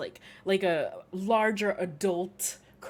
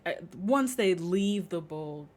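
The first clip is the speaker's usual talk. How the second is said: ordinary delivery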